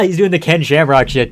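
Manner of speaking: ordinary talk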